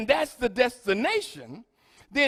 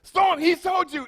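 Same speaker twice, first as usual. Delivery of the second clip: ordinary talk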